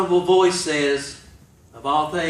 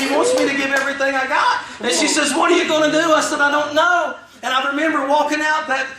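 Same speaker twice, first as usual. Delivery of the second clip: high, urgent testimony delivery; impassioned preaching; 9 semitones higher, 3 dB louder than usual. Real, not acted. Preacher retelling a frantic call to his wife, impassioned. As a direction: pour out the story at a preacher's pitch.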